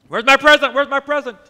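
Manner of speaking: put-on loud, high child's demand, shouted twice; an impatient little boy yelling for his present.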